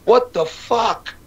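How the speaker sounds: high, slow, drawn-out what the fuck; mock-astonished teen reaction; stunned disbelief. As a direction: gape at it in disbelief. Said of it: "I'm like".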